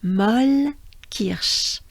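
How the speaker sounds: Molle Kirsch drawn out slowly; slow model. Shown as a demonstration. Teacher modeling Molle Kirsch slowly. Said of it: "it sounds like this".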